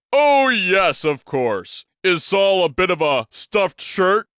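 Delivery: a hint of a booming big man, amused; lightly voiced big Texan. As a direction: start high with a jab, then hold court. Big Daddy's voice.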